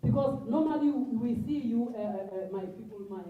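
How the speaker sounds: usual speaking voice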